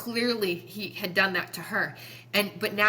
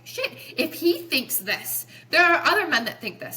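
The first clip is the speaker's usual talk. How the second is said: higher, louder retold jolt of realisation; alarmed realisation; 7 semitones higher, 4 dB louder than usual. Telling how she realised other men think this, alarmed.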